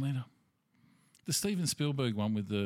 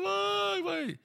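very high, strained crying voice; hammed-up high sobbing woman; mock crying woman. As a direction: wail it like a panicked crying victim. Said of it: "she's crying. She's going".

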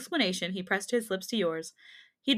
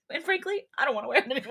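Ken impression; 5 semitones higher, faster than usual. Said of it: "I could see Ryan Gosling".

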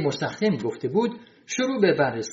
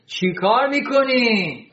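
raised, high, drawn-out yell; shouting; 7 semitones higher, 3 dB louder, much slower than usual. A narrator's reading. Janitor catching men at the door, shouting. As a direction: shout it slowly, stretching the words. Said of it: "داد کشید".

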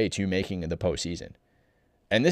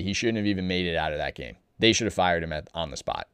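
usual speaking voice

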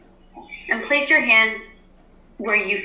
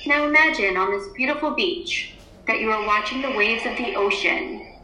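guided meditation. Acted. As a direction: ease the class into stillness. Teacher leads class meditation.

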